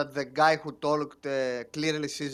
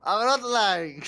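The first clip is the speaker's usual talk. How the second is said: insistent denial; 5 semitones higher, slower than usual. Acted. Player insists in character.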